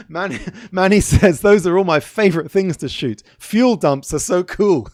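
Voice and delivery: giggly, high-pitched read-out; real amusement. Real, not acted. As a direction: read it through bubbling laughter. Amused reading a viewer's comment.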